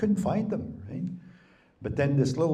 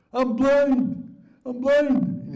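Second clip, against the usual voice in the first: high, loud cry of panic; panicked wail; 7 dB louder, much slower than usual. Retold or imitated. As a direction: wail I'm blind up high in terror. Realizes he lost eyes.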